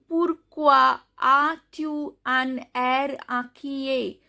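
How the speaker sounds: slow, word-by-word "pourquoi as-tu un air inquiet"; "inquiet" ending on the vowel, "air" on R; model French pronunciation. Shown as a demonstration. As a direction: read "pourquoi as-tu un air inquiet" slowly, silent S and T. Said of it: "S is not pronounced".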